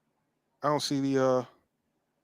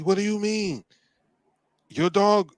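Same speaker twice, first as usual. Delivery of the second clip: alarmed question; 9 semitones higher than usual. Retold or imitated. Partner's alarmed question retold.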